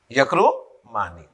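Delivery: "یکرمانی" slowly, lifted before a pause, then a low "Mani"; broken in two. Shown as a demonstration. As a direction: split "یکرمانی" before its ending for learners. Teacher repeats the form in parts.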